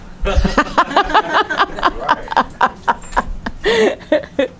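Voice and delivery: long, high run of laughter; amused laugh. Real, not acted. Teacher joking about a slow grandmother, long amused laugh. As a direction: laugh on and on at your own example.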